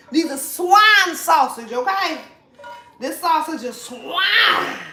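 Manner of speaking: slow emphatic proclamation; emphatic loud. Real, not acted. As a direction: declare it slowly and firmly. Emphatic announcement.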